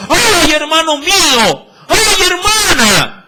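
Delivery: crying "ay, hermano mío" high and loud; lamenting cry of grief; mock wailing lament. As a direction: wail it like a mourner. Voicing mourners' lament in scripture.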